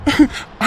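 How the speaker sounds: relieved laugh. Acted.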